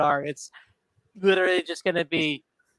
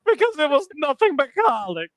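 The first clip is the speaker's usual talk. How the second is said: high silly voice; 11 semitones higher, 4 dB louder than usual. Pretend. Echoes the silly line.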